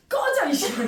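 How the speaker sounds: a touch of laughing mock-rough swearing; rough brush-off to students, played for laughs; mock rude retort. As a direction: spit it out jokingly, half laughing. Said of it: "했어".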